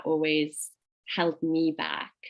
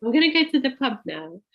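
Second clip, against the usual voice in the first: playful mock voice; 6 semitones higher, 4 dB louder, faster than usual. Joking voice of friend.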